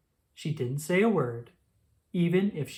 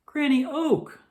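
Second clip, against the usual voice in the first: much higher, louder recognition; surprised; 10 semitones higher, 4 dB louder than usual. Acted. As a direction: realize it's Granny. Squirrel addresses Granny.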